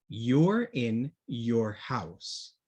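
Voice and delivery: "You're in your house" very slow, "You're" and "your" said the same; slow teacher model; slow demo sentence. Shown as a demonstration. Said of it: "aquí no hay cambio de pronunciación".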